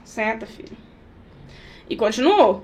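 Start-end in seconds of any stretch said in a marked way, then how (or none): 0.0-0.8 barely bothered, soft mother's telling-off; mother scolding her son without looking up from her phone, soft; quiet. Retold or imitated. Mother repeats the half-hearted scolding.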